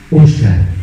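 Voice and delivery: God's voice, very low; deep divine call. Retold or imitated. Preacher retelling God calling him while praying, deep solemn call. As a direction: say the name low and weighty. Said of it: "se me pone y me dice".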